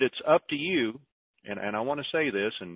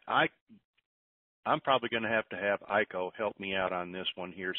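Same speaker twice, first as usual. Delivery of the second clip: ordinary talk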